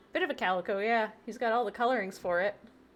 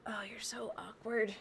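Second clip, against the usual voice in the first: whisper; 5 dB quieter than usual. Real, not acted. Cooing softly to her guinea pig.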